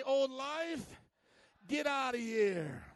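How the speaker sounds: put-on loud, drawn-out Chicago accent; hammed-up Chicago tough talk. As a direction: shout it in your best Chicago accent. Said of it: "That's my best Chicago accent".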